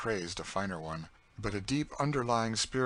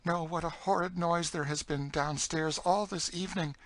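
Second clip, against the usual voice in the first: high-pitched, anxious story voice; high voice confiding her dread; frightened young woman character; 10 semitones higher than usual. Acted. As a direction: voice a fearful girl, pitched well up. Story figure, frightened Emmeline.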